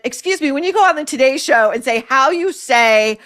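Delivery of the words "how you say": put-on Spanish accent, halting on the word; exaggerated Spanish-accented word search; mock Spanish accent. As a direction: mimic a fake Spanish accent, drawing out cucumber. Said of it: "slight Spanish accent".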